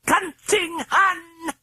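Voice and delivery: very high, slow, screaming British voice; furious British screech; British shriek. A narrator's reading. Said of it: "shrieked in the hoarse British accented voice".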